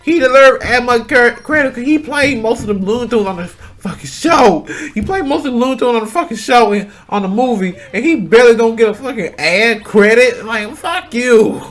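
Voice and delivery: high, loud furious complaint; real anger, pitched high and pushed; heated rant. Real, not acted. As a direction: vent the injustice at full volume. Angry rant about voice actor.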